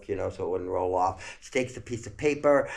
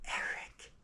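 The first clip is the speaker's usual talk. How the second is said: soft breathy whispered question; 10 dB quieter than usual. Retold or imitated. Mother hearing the name, hushed whisper.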